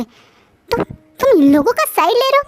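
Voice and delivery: outraged. A narrator's reading. Wife outraged at husband.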